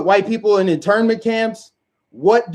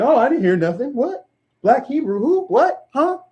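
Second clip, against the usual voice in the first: much higher, put-on clueless voice; mock feigned ignorance; 7 semitones higher than usual. Mocking liberals who pretend not to hear, in a high clueless voice. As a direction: play dumb, as if you never heard.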